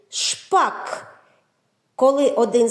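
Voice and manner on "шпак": Шпак said as one clear syllable. Shown as a demonstration. Teacher showing a one-syllable word, clear Шпак. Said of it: "склад один".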